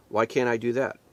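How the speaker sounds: ordinary talk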